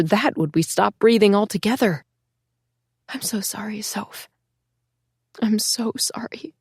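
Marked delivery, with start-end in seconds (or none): 3.1-6.5 soft unvoiced whisper; whispered apology. The narrator apologizing to her lost sister Soph, whispered. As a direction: breathe a broken apology.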